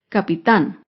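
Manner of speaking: capitán said ca-pi-TÁN, landing low on the stressed end; stress on last syllable. Shown as a demonstration. Teacher repeating the example word, last-syllable stress on capitán. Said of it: "Se acentúa en la última sílaba".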